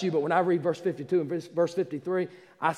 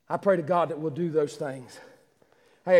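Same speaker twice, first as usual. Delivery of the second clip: ordinary delivery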